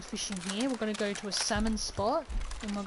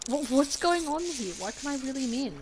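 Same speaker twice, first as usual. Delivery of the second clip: loud, higher, baffled protest; frustrated raised-pitch questioning; exasperated; 5 semitones higher, 6 dB louder than usual. Real, not acted. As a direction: demand to know why, voice raised. Frustrated the fish won't come.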